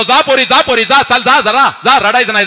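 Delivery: father at the car door telling his daughter to go; urging her on. Retold or imitated. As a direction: send her off, holding back tears.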